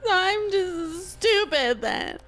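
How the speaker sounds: high, slow, drawn-out sulk; mock-hurt whine. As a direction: overreact as if deeply offended. Acted offended overreaction.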